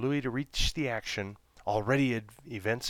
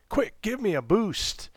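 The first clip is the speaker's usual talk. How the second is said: high, loud, hurried plea; urgent young monkey; 12 semitones higher, 6 dB louder than usual. A narrator's reading. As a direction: squeak it out in a rush. Monkey urgently asks for help.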